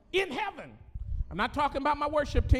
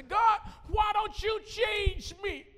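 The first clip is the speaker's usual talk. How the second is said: whiny prayer; 8 semitones higher than usual. Retold or imitated. Preacher mocking his own pity prayers to God, whining. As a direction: beg God like a sulking child.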